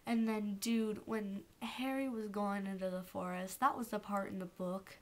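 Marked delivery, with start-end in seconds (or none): none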